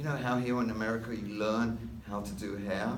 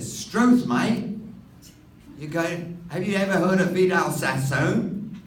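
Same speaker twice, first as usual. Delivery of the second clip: much higher, loud Australian astonishment; Aussie exclamation; 9 semitones higher, 9 dB louder, slower than usual. Retold or imitated. Australian barber amazed at the hair school plan, loud high Aussie voice. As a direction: blurt it like a stunned Aussie.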